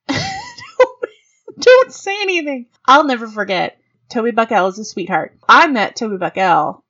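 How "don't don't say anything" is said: high, laughing don't; squeaky laughing don't say anything; laughing plea. Real, not acted. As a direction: plead through a laugh. Laughing pleading advice.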